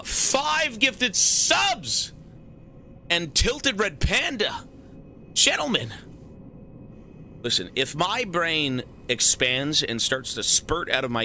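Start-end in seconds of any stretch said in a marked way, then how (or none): 0.0-5.9 excited hype. Real, not acted. Hyped over gifted subs.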